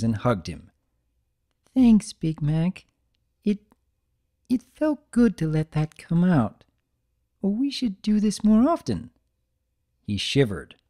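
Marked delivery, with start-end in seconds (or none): 1.7-9.1 timid, higher, relieved; gentle thanks with new confidence; Fluttershy voice. Acted. Fluttershy thanking Big Mac, higher and relieved.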